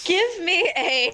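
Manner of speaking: cracking up mid-sentence; laughing. Real, not acted. Group cracks up at joke.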